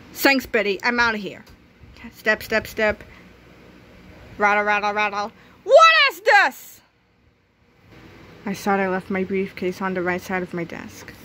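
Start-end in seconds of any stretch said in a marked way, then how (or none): 5.6-6.8 loud, higher, slow outraged question; angry surprise. Acted. Substitute finds his briefcase moved, loud and slow. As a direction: demand it, suspicious.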